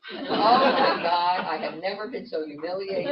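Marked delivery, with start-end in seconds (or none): 0.1-1.4 slow, loud laughing exclamation. Real, not acted. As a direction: laugh it out, mock mortified. Mortified laugh at punchline.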